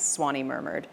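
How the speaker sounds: ordinary delivery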